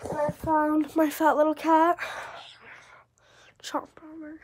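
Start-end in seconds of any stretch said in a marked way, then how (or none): 3.6-4.4 soft whisper to a cat. Real, not acted. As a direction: breathe it out, no voice.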